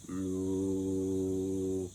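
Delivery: deepest, lowest sung note; extremely deep bass voice. Shown as a demonstration. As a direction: sing it as low as you possibly can. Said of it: "you're gonna sing very low".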